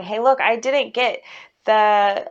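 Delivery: ordinary delivery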